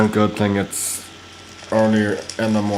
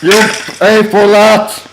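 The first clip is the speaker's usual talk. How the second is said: high, loud vengeful battle shout; full-voiced cry of revenge; yelled threat; 11 semitones higher, 9 dB louder than usual. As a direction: yell a threat at your game foe. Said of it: "I'm gonna be yelling myself hoarse".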